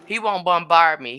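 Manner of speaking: normal talking voice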